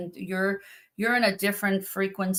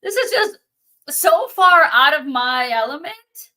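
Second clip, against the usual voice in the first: higher, louder burst of disbelief; freaking out; 7 semitones higher, 8 dB louder than usual. Retold or imitated. Getting the police call, freaking out louder and higher. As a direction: blurt out that you're in over your head. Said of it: "freaking out because I'm like".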